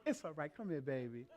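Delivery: soft, low soothing reassurance; sympathetic forgiveness. Acted. Wife forgiving her husband after his apology, soft sympathy. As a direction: gently comfort and forgive, dropping low. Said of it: "I want that sympathy forgiveness".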